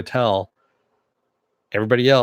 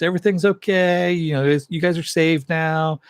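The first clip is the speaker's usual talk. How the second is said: mock reassurance; 7 semitones higher than usual. Hero telling plane passengers they are safe, mock reassuring. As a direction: imitate someone soothing a worried crowd.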